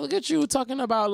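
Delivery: high-pitched playful cheer; teasing admiration. Retold or imitated. Mock-admiring reaction to the lyric.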